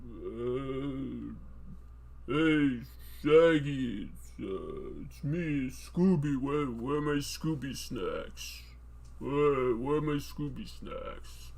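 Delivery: low, slow mumbling Stallone doing Scooby; low slow Stallone-style Scooby voice; Stallone as Scooby-Doo. Pretend. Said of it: "Sly Stallone doing an impression of Scooby-Doo".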